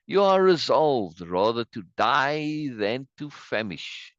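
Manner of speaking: drawn-out theatrical proclamation; declaiming citizen. Acted. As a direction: declaim it slowly to the assembled crowd. Reads citizen's speech to crowd.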